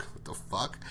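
breathy laughing whisper; laughing disbelief. Retold or imitated. Andrea reacting to Merle's pass, laughing under her breath.

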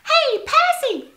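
very high, squeaky mouse chatter; a chatty mouse telling a tale. A narrator's reading. Moby calling out.

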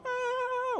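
high-pitched imitated wail of a crying kid; mock crying child. Young kid running to a parent, mock crying. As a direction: wail like a hurt little kid, hammed-up. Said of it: "they'd be crying".

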